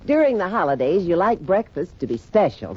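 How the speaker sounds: ordinary talk, nothing marked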